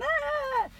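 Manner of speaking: a sudden very high yelp; mock panicked yelp. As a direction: squeal it out like legs jumping in panic. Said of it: "and my legs are going".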